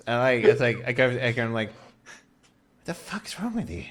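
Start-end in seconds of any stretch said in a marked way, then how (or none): 1.7-3.8 soft, slow, hissed question; hushed demand. Acted. Ace pulling Edgar aside, hushed and slow. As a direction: confront him under your breath.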